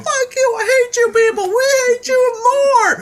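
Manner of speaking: very high, heated shouting match; imitated screaming row; yelling back and forth. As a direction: trade hateful yells between singer and crowd. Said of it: "we're yelling back and forth".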